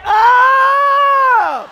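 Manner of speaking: high, very loud call; preacher's rallying cry; huge shouted up. Acted. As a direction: lift the whole room with one shout. Preaching to congregation.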